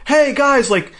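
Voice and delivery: high, loud mock summons; calling people over. Car dealer calls two guys over, mock calling out. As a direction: call out brightly pitched, hammed up.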